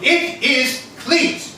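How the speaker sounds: "Cleet" drawn out slowly, word by word; slow emphatic repeat. Acted. Repeating his name slowly for Gaston, very slow. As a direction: spell it out slowly for him. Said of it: "You're supposed to say".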